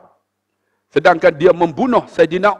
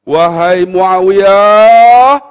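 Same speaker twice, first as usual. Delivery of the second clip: very slow, loud, drawn-out call; solemn address; 7 dB louder, much slower than usual. Acted. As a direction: stretch out "Wahai" like a grave summons. Umar solemnly addresses Muawiyah. (seruan lambat dan kuat)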